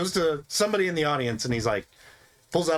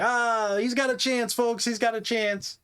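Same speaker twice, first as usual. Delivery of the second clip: mock show host; 8 semitones higher, slower than usual. Host hyping folks.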